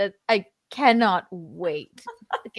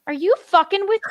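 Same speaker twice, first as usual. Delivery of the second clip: high mock outrage; 13 semitones higher, 6 dB louder, much faster than usual.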